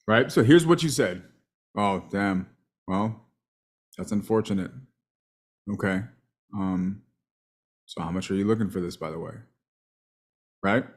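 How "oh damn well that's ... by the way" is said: low, soft, uninflected delivery; monotone imitation. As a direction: imitate a client's dull, understated sales call. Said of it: "more or less how you said it".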